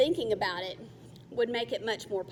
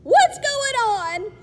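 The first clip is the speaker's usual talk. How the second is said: much higher, loud, slow yell; panicked yell; 14 semitones higher, 13 dB louder, slower than usual. Acted. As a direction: cry out in alarm from the net. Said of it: "Alex yelled".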